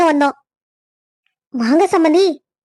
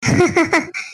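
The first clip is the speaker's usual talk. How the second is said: a short coy laugh, proud of the dress. Acted. Bride laughing at the groom's praise of her dress, coy laugh. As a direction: giggle with pleased pride.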